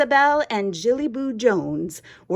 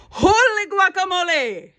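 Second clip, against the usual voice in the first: astonished exclamation; 10 semitones higher, 4 dB louder than usual. A narrator's reading. Kitten spotting his mirror reflection, astonished exclamation. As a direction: burst out in high-pitched amazement. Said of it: "exclaimed Skippy John Jones".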